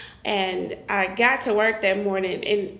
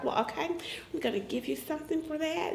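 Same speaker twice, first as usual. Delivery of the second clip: much softer, offhand doctor's reply; softly quoting doctor; 4 semitones higher, 12 dB quieter, faster than usual. Retold or imitated. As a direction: drop the voice for the dry doctor. Doctor quoted, dry reply.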